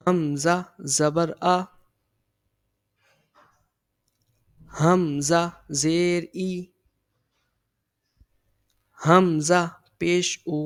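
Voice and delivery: slow, careful drill of the vowels; slow recitation. Shown as a demonstration. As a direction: spell each syllable out slowly for the class. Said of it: "اب ہم start کرتے ہیں". (آہستہ آہستہ سبق پڑھانا)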